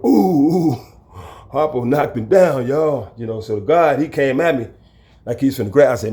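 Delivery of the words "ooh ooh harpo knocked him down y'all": cheering inmates. Retold or imitated.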